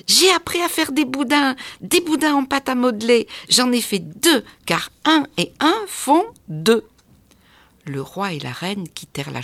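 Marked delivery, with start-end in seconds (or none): 0.1-6.8 aigu et fort, enfant surexcité; s'écrie. A narrator's reading. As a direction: crie fièrement ta découverte aux parents. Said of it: "Petit Prince Pouf s'écria". (l'enfant crie tout fier à ses parents)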